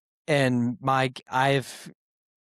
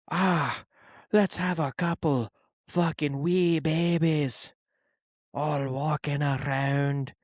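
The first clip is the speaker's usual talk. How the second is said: put-on Scottish accent. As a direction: introduce the show in a joking Scottish accent, slowing near the end. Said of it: "Hit a Scottish accent to intro the show".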